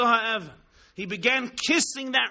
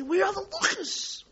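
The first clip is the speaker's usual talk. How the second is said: a much higher, anguished cry of loss; desperate cry; 11 semitones higher than usual. Acted. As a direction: cry out for the lost tablets.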